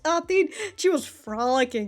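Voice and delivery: ordinary talk, nothing marked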